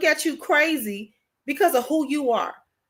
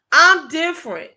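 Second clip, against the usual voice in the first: proud declaration; 6 dB louder than usual. Acted. Proud repeat of quoted claim.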